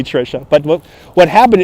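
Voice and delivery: ordinary delivery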